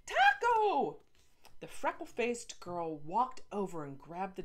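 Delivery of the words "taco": very high, very loud shrieking call; screeching shout. Acted. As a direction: screech the dog's name. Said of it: "with each screech".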